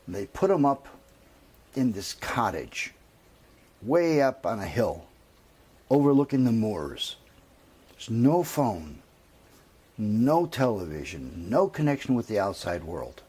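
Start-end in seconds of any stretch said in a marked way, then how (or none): none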